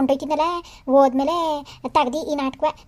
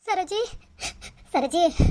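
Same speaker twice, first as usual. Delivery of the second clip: anxious calling; 5 semitones higher, 5 dB quieter than usual. Acted. Calling unconscious daughter's name.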